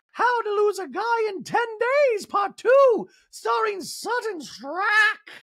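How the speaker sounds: very high, drawn-out shouting impression; Dorit. Host mimicking a housewife's mock movie title, high shouted impression. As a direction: announce it in a piercingly high put-on voice.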